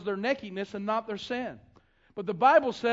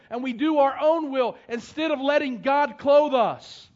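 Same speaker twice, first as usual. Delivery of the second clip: louder, higher preaching at full force; rising sermon; 4 semitones higher, 5 dB louder than usual. Acted. Pastor urging his congregation, building to full-force preaching. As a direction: drive the point home to the congregation.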